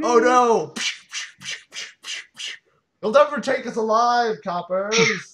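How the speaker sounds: loud, high hammed-up gangster defiance; mock gangster. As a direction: shout at the coppers like a movie crook. Mock gangster defying police.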